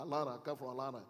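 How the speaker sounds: put-on Atlanta accent, softly; imitating an Atlanta native's accent. Shown as a demonstration. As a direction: say Atlanta like an Atlanta local, dropping the t's. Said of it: "I try to say it like people from Atla".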